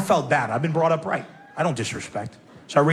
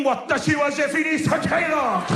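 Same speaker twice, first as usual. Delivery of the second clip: mock anime move name, raised pitch; Japanese cartoon attack call; 6 semitones higher than usual. Naming the teacher's slap, mock Japanese cartoon shout. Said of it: "if this was a Japanese cartoon".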